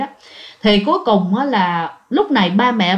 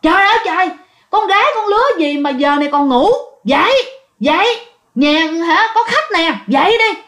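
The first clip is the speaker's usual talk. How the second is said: higher-pitched, fussing wake-up call; old-time woman's scolding; 6 semitones higher than usual. Retold or imitated. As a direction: nag a sleeper out of bed. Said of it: "bà này đứng ngay cái giường bạn luôn, mới nói".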